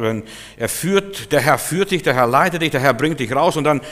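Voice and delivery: usual speaking voice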